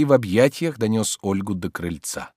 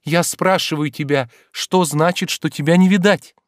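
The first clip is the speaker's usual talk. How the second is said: high, insistent demand, growing louder; impatient; 8 semitones higher, 5 dB louder than usual. A narrator's reading. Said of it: "перебила она нетерпеливо". (нетерпеливо, требовательно)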